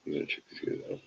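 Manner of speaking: hushed muttering to the pets; soft whispered aside off-mic. Real, not acted. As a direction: whisper under the breath.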